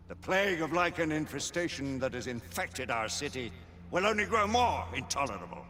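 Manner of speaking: grave ceremonial address to the knights; solemn oration to the assembled order; formal speech. Acted. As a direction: open the council with gravity. Lord Chancellor addresses the council.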